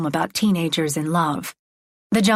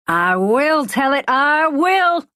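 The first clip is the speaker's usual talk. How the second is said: slow, rising and louder yell; preacher yells; 7 semitones higher, 4 dB louder, slower than usual. A narrator's reading. Maxwell answers the crowd, slow rising yell. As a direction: throw it back at the crowd. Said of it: "he yells".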